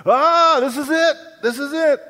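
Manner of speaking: high, loud panicked cry of doomed passengers; panicked screaming. Retold or imitated. English passengers on a sinking ship, panicked high cry. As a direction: shriek it like the ship is going down.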